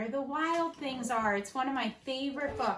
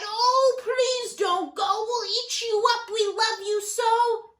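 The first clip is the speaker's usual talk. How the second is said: much higher, louder sing-song begging; pleading wild things; 5 semitones higher, 6 dB louder than usual. Acted. Wild things to Max, high loud pleading. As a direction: beg him not to leave. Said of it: "But the wild things cried".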